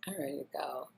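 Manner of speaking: whispered inner thought; whispered aside, wanting to leave. Retold or imitated. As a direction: mutter it under your breath.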